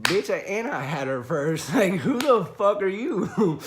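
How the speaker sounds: high, loud indignant challenge breaking into laughter; hammed-up outraged retort; retold anger. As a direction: call him out, half laughing. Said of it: "I know I had that anger".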